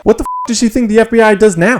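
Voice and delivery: loud, high outraged outburst; outraged shout. Real, not acted. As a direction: blurt it out in disbelief. Outraged reaction to clip.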